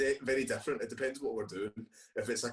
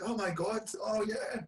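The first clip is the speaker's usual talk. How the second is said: higher-pitched imitation of delighted recognition; excited recognition; 10 semitones higher than usual. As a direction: act out suddenly knowing the song. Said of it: "You will come along and be like".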